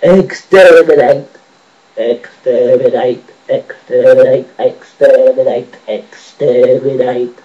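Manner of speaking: loud, slow robotic monotone; Dalek. Acted. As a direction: chant it like a menacing machine, syllables stretched. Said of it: "sounds like a Dalek".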